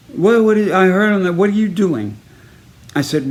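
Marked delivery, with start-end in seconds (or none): 0.1-2.2 high, raised confronting voice, easing slightly; indignant coach. Retold or imitated. Baseball coach confronting player about musical, indignant coach. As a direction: imitate a coach demanding an explanation.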